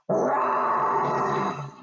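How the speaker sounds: long rasping big-cat roar. Pretend. Presenter roaring with children as a snow leopard, pretend roar. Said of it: "Shall I make a big roar?".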